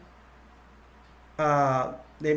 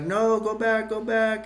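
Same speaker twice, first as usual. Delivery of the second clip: higher mock shout; calling out warning; 7 semitones higher than usual. Survivors warning the paramedics away from the door, calling out. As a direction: wave them off from behind the door.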